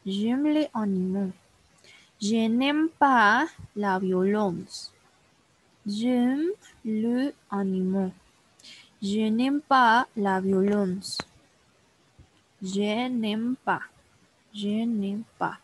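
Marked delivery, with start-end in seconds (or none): 2.2-11.2 slow, deliberate French model sentences; slow model reading. Shown as a demonstration. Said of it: "teacher models French sentences slowly". 12.6-15.6 "Je n'aime pas" slow, weight on "n'aime", falling end; slow repeat drill for a learner. Shown as a demonstration. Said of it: "teacher drills phrase slowly".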